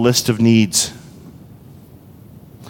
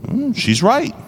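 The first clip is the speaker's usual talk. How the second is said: usual speaking voice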